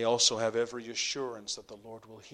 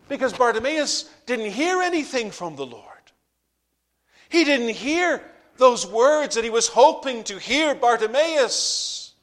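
high, loud pulpit cry; impassioned preaching; 11 semitones higher, 12 dB louder than usual. Acted. Preaching at full force.

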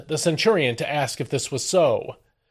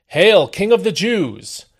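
mocking salute; 5 semitones higher, 5 dB louder, slower than usual. Soldiers mock Jesus with salute.

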